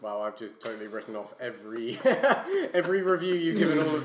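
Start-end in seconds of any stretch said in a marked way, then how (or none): 2.0-2.7 burst of laughter. Real, not acted. Laughs at friend's verdict.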